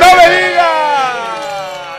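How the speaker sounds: high, loud, drawn-out announcer cry; mock-amazed drawn-out shout; hyped exclamation. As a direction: stretch it out like a show host. Host hypes the joke segment.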